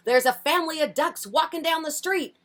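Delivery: high, excited policeman reporting in; urgent phone report. Acted. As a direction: call it in with urgency.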